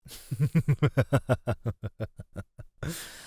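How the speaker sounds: long, rippling chuckle that tapers off; drawn-out menacing chuckle; amused villain laugh. Acted. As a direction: chuckle slowly at your prey. Predator amused by its captive's refusal.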